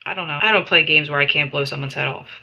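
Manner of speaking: ordinary talk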